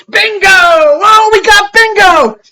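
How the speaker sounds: high, loud triumphant yell; gleeful cop shout. Retold or imitated. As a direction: crow it like you just won. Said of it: "And then he goes".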